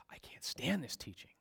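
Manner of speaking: hushed, whispered complaint; disgusted whisper. Acted. Preacher voicing disciples who withdraw, disgusted whisper. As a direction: whisper it as if fed up. Acts departing disciples, under his breath.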